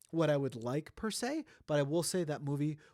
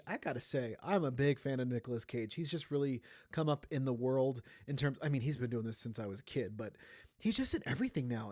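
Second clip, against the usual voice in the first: ordinary talk, nothing marked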